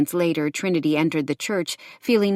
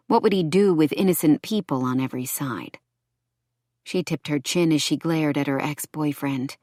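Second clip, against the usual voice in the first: ordinary delivery, nothing marked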